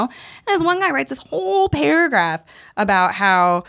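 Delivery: ordinary delivery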